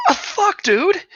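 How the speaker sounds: sudden high yelp of pain and shock; pained outburst. Acted. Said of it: "And the guy's like".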